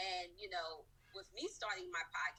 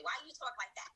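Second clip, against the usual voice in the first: mocking child's taunt, higher; kids teasing; 6 semitones higher, 3 dB louder than usual. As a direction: imitate a schoolkid jeering. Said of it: "kids would say".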